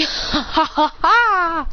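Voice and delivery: a drawn-out put-on villain laugh; mock laugh. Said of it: "Then he laughs going".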